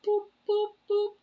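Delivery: tiny high-pitched boop noises, playful; high squeaky boops. Pretend. Reader teasing viewers with a playful squeaky boop.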